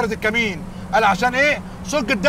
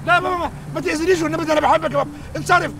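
scared pleading; 6 semitones higher than usual. Acted. الابن يترجى روح أبيه الخائف منها.

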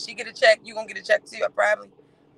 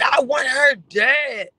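slow, loud imitated shout; hammed-up rage, dragged out; mock furious yell; 4 semitones higher, 6 dB louder, much slower than usual. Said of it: "she said".

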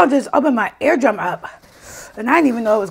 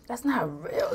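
usual speaking voice

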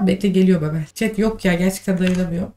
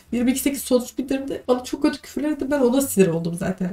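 high, softer, tearful complaint; upset and close to crying; ağlamaklı; 4 semitones higher, 4 dB quieter than usual. Real, not acted. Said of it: "Oğlum, niye ağlıyorsun?". (ağlamaklı, tiz ve kısık sesle)

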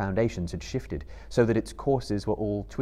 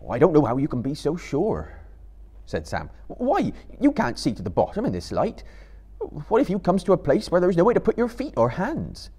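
higher, louder worried hobbit; Sam; 7 semitones higher, 4 dB louder than usual. A narrator's reading. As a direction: Sam fretting about the dark. Sam doubts the plan.

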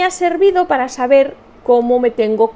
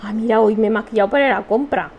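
ordinary talk, nothing marked

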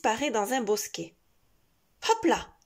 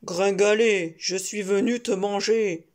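loud growling wolf voice; 2 semitones lower, 5 dB louder than usual. Acted. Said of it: "le loup grogne et postillonne".

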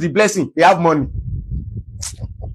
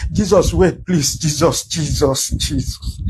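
mock begging; 3 semitones lower, slower than usual. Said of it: "you can't beg? You can't say".

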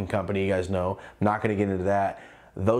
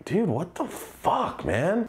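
exasperated; 8 semitones higher, much slower than usual. Retold or imitated. Exasperated aside while detained.

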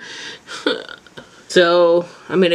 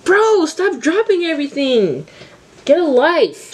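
self-scolding; 9 semitones higher than usual. Real, not acted. Scolding herself for dropping.

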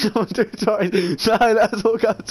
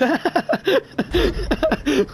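high laugh; 8 semitones higher than usual. Real, not acted. Laughing at the game.

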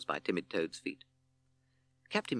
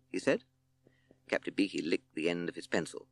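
plain reading voice

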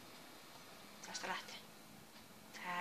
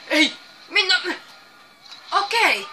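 loud, higher rapid what what; flustered shout; 3 semitones higher, 13 dB louder than usual. Real, not acted. Gamer flustered and shouting at a surprise. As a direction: blurt it all out in a panic. Panicked exclamations in game.

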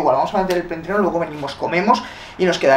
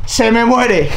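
alarmed; 6 semitones higher, 5 dB louder, much slower than usual. Real, not acted. Plant dying, sudden alarm.

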